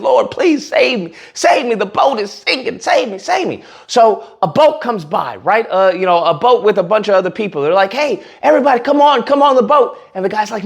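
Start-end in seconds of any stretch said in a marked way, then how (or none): 0.0-3.6 badly faked desperate prayer; mock screaming. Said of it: "He starts screaming". 7.9-10.0 higher-pitched beckoning call; retold shout at normal volume; mock calling out. Boat people call out to man.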